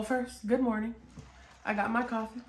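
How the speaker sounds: ordinary talk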